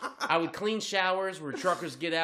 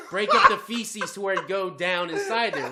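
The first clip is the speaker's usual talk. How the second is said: ordinary talk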